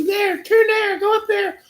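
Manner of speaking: high, loud yelled directions; shouted directions. Retold or imitated. Drunk passenger screeching directions at the driver. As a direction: shriek the turn at the driver.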